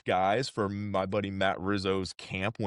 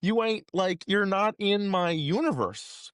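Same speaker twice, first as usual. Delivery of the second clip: imitating the director; 13 semitones higher than usual. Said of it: "he's like".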